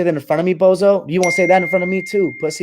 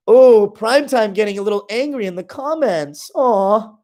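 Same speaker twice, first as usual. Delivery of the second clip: higher, sing-song mock pity; 6 semitones higher, slower than usual. Real, not acted. Streamer mocking the angry commenter with fake sympathy.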